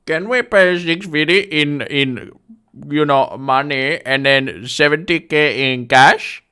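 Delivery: high, loud, slow Indian-accented imitation. Home buyer asking agent to take cash, Indian accent. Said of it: "spot on impression".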